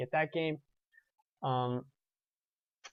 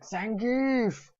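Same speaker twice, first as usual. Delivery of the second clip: mock scream of a gamer's cry, higher, drawn out; grito imitado; 8 semitones higher than usual. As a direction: scream it like the roommate yelling from his corner. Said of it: "I just hear him scream".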